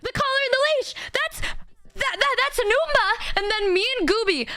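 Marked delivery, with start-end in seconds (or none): 0.0-3.4 much higher, sudden shocked discovery; excited realization. Retold or imitated. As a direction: gasp it out as it dawns on you.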